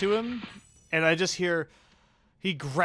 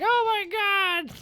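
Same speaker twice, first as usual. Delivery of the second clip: much higher, slow falsetto panic; terrified squeak; 6 dB louder, slower than usual. Retold or imitated. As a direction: squeal in mock terror. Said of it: "And he's like".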